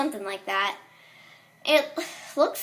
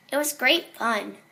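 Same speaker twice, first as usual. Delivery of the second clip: usual speaking voice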